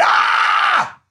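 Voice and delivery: loud open "ah" yell; imitated frustrated yell. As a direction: cry out as if cheated.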